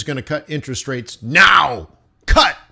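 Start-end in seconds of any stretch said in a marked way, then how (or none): 2.3-2.6 a sudden loud, high mocking "Cut!"; loud derisive cry; mocking shout. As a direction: bark it out like a scoff. Mocking idiots expecting a rate cut.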